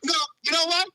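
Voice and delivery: ordinary talk, nothing marked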